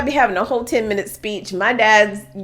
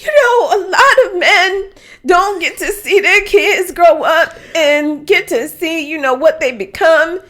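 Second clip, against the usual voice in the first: higher, louder, laughing mock tearful grace; imitating dad crying; 8 semitones higher, 4 dB louder than usual. Daughter mimicking her father weeping through grace, laughing mock sobs. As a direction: ham up an old man choking up with pride. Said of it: "I'm gonna reenact".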